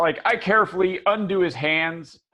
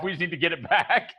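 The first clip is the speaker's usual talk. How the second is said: normal talking voice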